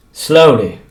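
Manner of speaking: ordinary delivery, nothing marked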